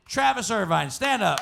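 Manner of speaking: loud stage announcement; announcer's call. Real, not acted. Host calls a contestant for applause, loud announcing. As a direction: call the name out to the room.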